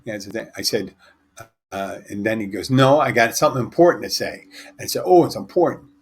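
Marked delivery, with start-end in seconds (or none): 2.7-4.5 loud, higher mock insistence; insistent retort. Acted friend insisting to be heard. 5.1-5.9 loud, high teasing echo; mock-impressed reply. Mock-impressed reply in acted exchange.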